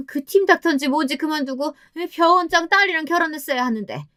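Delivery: high-pitched, bitter berating; angry tirade. A narrator's reading. Mother-in-law blaming her daughter-in-law for her son's prospects, angry tirade. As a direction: keep railing at her, high and accusing. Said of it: "다짜고짜 소리를 지르시는".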